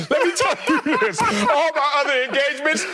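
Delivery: high, fast, laughing through the words; can't hold back the laugh. Real, not acted. Laughing through his own story.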